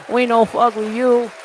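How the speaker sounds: hammed-up Chinese accent between laughs; mock Chinese accent. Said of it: "Why did I say that in Chinese voice?".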